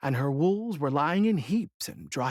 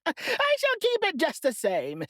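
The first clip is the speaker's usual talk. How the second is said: high, mocking witch voice; gloating witch; 13 semitones higher than usual. A narrator's reading. Witch taunting Dorothy over the shoe, high and mocking. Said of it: "said the witch, laughing at her".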